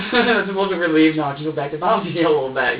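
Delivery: laughing loudly with relief; relieved loud laugh while telling it. Real, not acted. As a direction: let the relief spill out in laughter. Relieved after fearing baby hurt.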